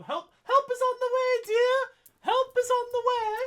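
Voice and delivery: high, bright mock British accent; hammed-up British accent, pitched high and loud. Streamer reading a chat message in a put-on British accent. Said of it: "that was my attempt at a British accent".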